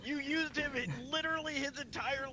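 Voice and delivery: high, slow, laughing yell; laughing outraged shout. Real, not acted. Player yells at Gilmore, laughing outrage. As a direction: accuse him through laughter.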